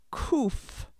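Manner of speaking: Kuf with a hard throaty k; Hebrew letter Kuf. Shown as a demonstration. Teacher modelling Kuf for learners to repeat. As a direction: say Kuf slowly for the class to echo. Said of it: "Repeat after me".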